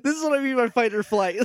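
high, helpless laughing protest. Real, not acted. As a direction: talk through real laughter. Cracking up after the whispering.

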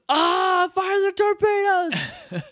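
high, slow villainess shout. Retold or imitated. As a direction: screech the order like a cartoon villainess. Villain Divatox orders torpedoes fired.

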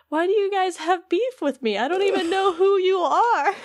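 lightly voiced confused Pete, laughing through it.

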